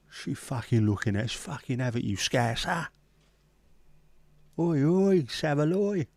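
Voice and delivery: put-on Cockney hard-case banter. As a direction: do a mock Cockney geezer, rising high at the end. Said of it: "do the old Cockney bowl as well".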